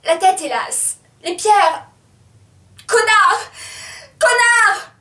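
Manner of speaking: high, loud cries building to a scream; escalating, distressed shouting; anguished cries. Acted. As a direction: cry each fragment out harder.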